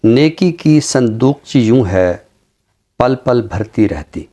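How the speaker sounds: slow, measured ghazal recital with long pauses; poem recitation. Acted. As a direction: savour each line of the couplet, unhurried. (धीमी, ठहरी हुई ग़ज़ल की अदायगी)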